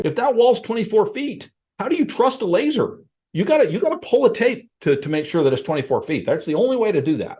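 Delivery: lightly voiced old-timer who won't trust a laser; doubting old-school appraiser. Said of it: "Yes, I heard appraisers say".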